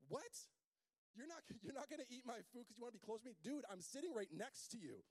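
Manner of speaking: high, put-out friend at the table; indignant buddy. Acted. Groom to friend refusing food, high and indignant. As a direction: play the best friend, baffled and hurt.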